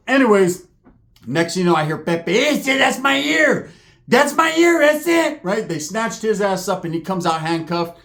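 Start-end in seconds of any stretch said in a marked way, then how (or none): 2.4-5.4 slow, higher cry of pain; pained high yelp. Retold or imitated. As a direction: whine as the cop grabs his ear. Pepe yelps while handcuffed.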